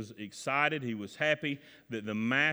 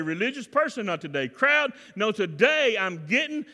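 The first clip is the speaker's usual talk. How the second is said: defiant declaration; 8 semitones higher, 8 dB louder than usual. Acted. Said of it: "throw their shoulders back and say".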